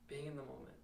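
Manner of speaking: very soft, almost to oneself; hushed refrain. Acted. Student recalling the stage in a senior monologue, hushed.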